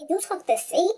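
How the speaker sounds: the wife asking about him, voiced high; high mock wife voice. Wife on the phone asking about her man, high and probing.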